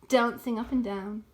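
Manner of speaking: ordinary delivery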